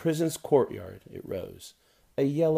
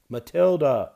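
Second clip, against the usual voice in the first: higher, louder call from below; calling up the stairs; 5 semitones higher, 8 dB louder than usual. Acted. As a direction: call her daughter from downstairs. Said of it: "Mother's voice called up the stairs".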